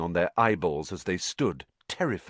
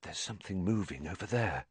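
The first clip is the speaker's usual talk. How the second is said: soft breathy hush; Harry warns Ron in the dark forest; breathed; 10 dB quieter, faster than usual. A narrator's reading. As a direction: breathe it out barely audible. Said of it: "Harry breathed".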